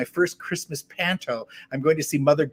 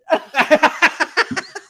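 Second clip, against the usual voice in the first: high burst of laughter; 13 semitones higher, 9 dB louder than usual. Real, not acted. Laughing at own story.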